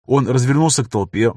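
ordinary delivery

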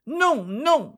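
voix aiguë de fillette suppliante; fillette qui supplie. A narrator's reading. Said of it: "dit la fillette". (la fillette supplie sa mère de ne pas vendre l'ânon)

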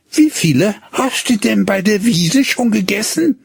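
put-on high, solicitous old woman's question; caring grandmother. Grandmother asks the boy how many he ate, high and caring. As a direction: ask like a fussing granny. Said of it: "fragte sorglich die Großmutter".

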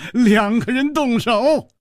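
high, slow words through laughter; laughing retort. A narrator's reading. Chu mocks the old man, laughing as he speaks. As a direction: laugh into the teasing quote. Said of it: "楚留香笑着说".

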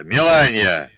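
loud call of her name; loud angry summons. Acted. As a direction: shout her name across the yard. Calling her name from haystack.

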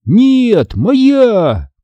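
high, insistent cry of ownership; heated childish contradiction; squabbling child. A narrator's reading. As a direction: fight your brother for it, high-pitched. Children fighting over the sponge.

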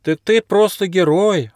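high-pitched, admiring exclamation; exclaiming platypus. A narrator's reading. Platypus praising the old dog, high exclamation. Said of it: "воскликнул Фли".

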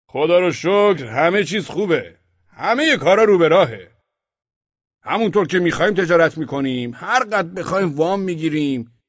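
a merchant who smells a trap; wary merchant. Acted. Merchant boasts all is well.

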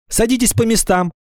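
high, firm order from a class leader; commanding. A narrator's reading. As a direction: order everyone to their seats. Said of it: "скомандовала Ира Сыркина". (командным тоном)

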